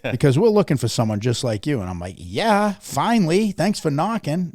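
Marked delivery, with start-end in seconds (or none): 0.1-1.8 loud, hammed-up sales pitch; imagined visitor's offer. 2.2-4.5 high, loud, hammed-up excitement; mock delight. Acts out own delighted reply.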